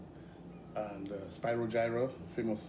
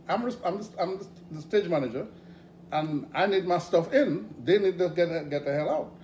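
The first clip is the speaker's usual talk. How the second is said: bossy demand; 7 semitones higher, 6 dB louder than usual. Retold or imitated. Stage manager demanding her gear come in, bossy. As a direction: lay down the law, pushy. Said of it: "she said".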